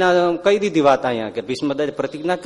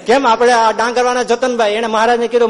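raised, animated storytelling; lively katha telling; 8 semitones higher, 7 dB louder than usual. Acted. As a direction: call it out to the congregation. (ઉત્સાહભરી કથા)

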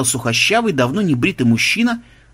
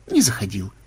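low, falling denial; denying side; 5 semitones lower, 4 dB quieter than usual. Acted. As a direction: drop it low as a dull reply. Acts out back-and-forth dispute. (отрицание, пониже)

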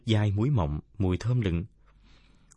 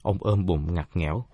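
ordinary delivery

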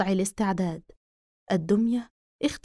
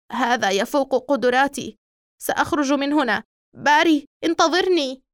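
a frightened officer, louder and rising; panicked policeman; 3 semitones higher, 4 dB louder than usual. Acted. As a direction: bolt out in a scared policeman's voice. Panicked policeman fleeing the house.

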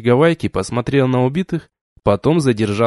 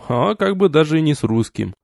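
plain reading voice

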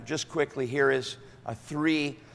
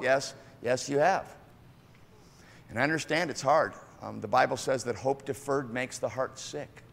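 ordinary talk, nothing marked

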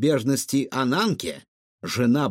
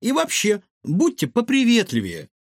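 higher-pitched firm reproof; stern; 9 semitones higher than usual. A narrator's reading. Ilona tells the cook to be friendlier, stern. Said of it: "строго сказала ему Илона".

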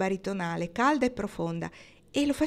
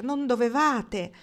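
slow, raised exclamation; higher, drawn-out exclamation; exclaiming; 5 semitones higher, 4 dB louder, slower than usual. A narrator's reading. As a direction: draw out the fond protest. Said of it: "esclamò Kendra".

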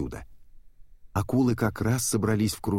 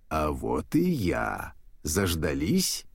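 low, slow, drawn-out taunt; menacing shark; 4 semitones lower, slower than usual. Acted. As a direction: announce yourself slowly, toying with prey. Huge shark bursts in on prey.

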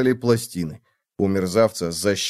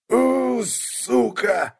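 growled curse; 11 semitones higher, much slower than usual. Acted. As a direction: snarl it, far and rough. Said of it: "зарычал тот". (злобное рычание)

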